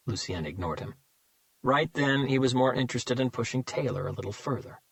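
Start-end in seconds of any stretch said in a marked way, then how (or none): none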